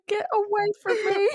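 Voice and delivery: high-pitched exasperated imitation; mock shoo them off in exasperation; frustrated. Shopkeeper fed up with annoying customers, imitating frustration. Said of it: "She's just so frustrated".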